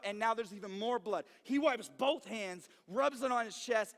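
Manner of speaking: usual speaking voice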